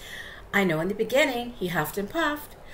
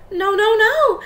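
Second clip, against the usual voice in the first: high, loud refusal; defiant little wolves; 13 semitones higher, 11 dB louder than usual. Acted. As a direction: cry out no in a high voice.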